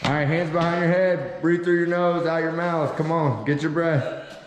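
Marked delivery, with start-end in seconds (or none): none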